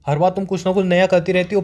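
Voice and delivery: normal talking voice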